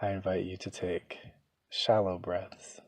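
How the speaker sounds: soft, hushed meditation voice with long pauses; guided meditation. Real, not acted. Begins leading the guided meditation.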